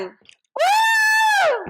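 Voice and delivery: high, loud party whoop; excited whoop. Acted. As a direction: let out a wild, tipsy cheer. Drunk girl dancing at party.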